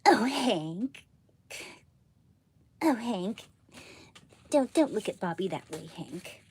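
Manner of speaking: lightly voiced Texan wife; Peggy Hill voice. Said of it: "I'll try to do Peggy".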